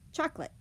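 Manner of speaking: "Chocolate" in two syllables, CHOK-lit; syncopated schwa. Shown as a demonstration. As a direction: drop the middle vowel of "Chocolate". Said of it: "we only say two".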